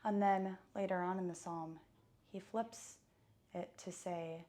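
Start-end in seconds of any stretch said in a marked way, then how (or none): none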